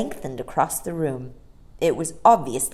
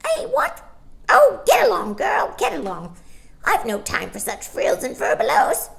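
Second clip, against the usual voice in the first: high, loud scolding bird voice; shrill starling; 13 semitones higher, 5 dB louder than usual. Acted. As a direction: squawk it like a cheeky starling. Starling brushes her off.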